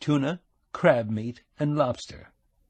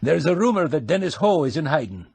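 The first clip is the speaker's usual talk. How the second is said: higher, hearty Scottish brogue; 6 semitones higher than usual. A narrator's reading. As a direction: roll out a shopkeeper's Scottish brogue. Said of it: "putting on the brogue".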